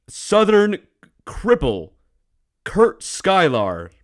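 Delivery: grand broadcast introduction; mock news announcer. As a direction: announce the weatherman with big pauses. Mock news host introduces weatherman.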